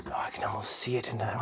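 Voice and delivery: hushed, breathless whisper of wonder; awed whisper. Real, not acted. Reviewer marvels at the figure's scales, whispering. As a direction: whisper as if afraid to break the spell.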